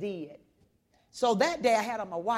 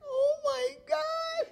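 much higher, slow overwhelmed outcry; overwhelmed cry; much slower than usual. Retold or imitated. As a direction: let it burst out, stunned. Overwhelmed when she says I love you.

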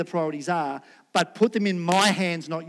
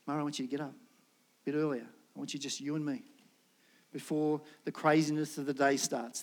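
softer, lower, tender invitation; gentle voice of God; 5 semitones lower, 8 dB quieter than usual. Pretend. God gently inviting Murray to rise early, soft. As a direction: coax him gently out of bed.

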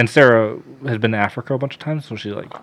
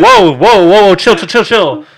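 loud, raised, repeated interruption; urgent calming; 5 semitones higher, 8 dB louder than usual. Retold or imitated. Paramedics stopping him slapping Keith, urgent calming. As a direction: call out to break up a fight.

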